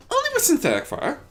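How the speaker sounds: high, fast dainty mare; quick reassuring lady; Rarity voice. A narrator's reading.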